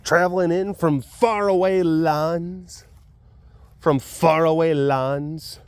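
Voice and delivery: slow, drawn-out epic narration; mock-epic announcement; grand storyteller. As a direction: make it sound legendary.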